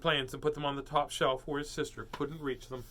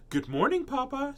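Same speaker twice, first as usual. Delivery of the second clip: high, sugary greeting; sweetly pleasant; 11 semitones higher than usual. A narrator's reading. Sister greets Papa, pointedly sweet and pleasant. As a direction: sing it out extra nicely. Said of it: "as pleasant as you please".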